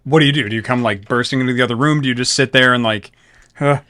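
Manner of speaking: loud insistent game master; loud pressing question. Real, not acted. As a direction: push the player to answer.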